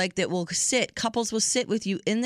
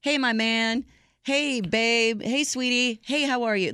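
scrambling greetings; 5 semitones higher, slower than usual. Retold or imitated. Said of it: "it's like".

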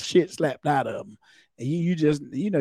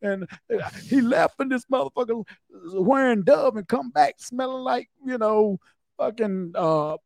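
higher, sassy indignant rant; angry partner; 8 semitones higher, 4 dB louder than usual. Retold or imitated. Wife complaining to officers about cheating husband, higher sassy rant.

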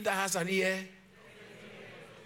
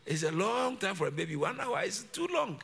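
usual speaking voice

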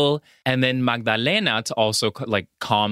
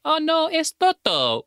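high squeaky mock cry of dismay; hammed-up little girl wail; mock Dorothy. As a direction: play the panicked Dorothy, way up high. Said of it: "she's like".

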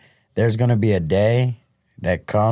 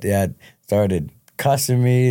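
ordinary delivery

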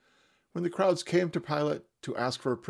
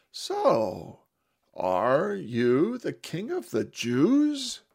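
slow, higher, teasing drawl; amused mocking question; 6 semitones higher, 5 dB louder, much slower than usual. Pilate mockingly asks Jesus if he is king. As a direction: tease him with a knowing, drawn-out question. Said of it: "Jesus caught his inflection".

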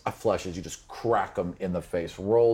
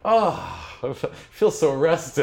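high, hammed-up sigh of relief; mock bliss; 6 semitones higher, 4 dB louder than usual. Game master mocking the player's contentment, hammed-up bliss. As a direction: swoon like it was heavenly.

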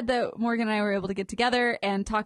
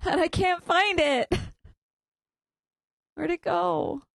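flustered, higher pitched searching; 8 semitones higher than usual. Real, not acted. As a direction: hunt for it, rattled and rushed. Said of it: "feeling a little less flustered".

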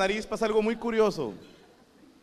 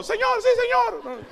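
very high, slow mock soldier voice; soldier's reply; 3 dB louder than usual. The left arm answering the brain, high mock soldier. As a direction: squeak out a cartoon soldier's salute. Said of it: "brazo izquierdo".

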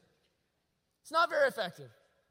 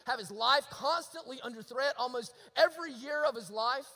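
usual speaking voice

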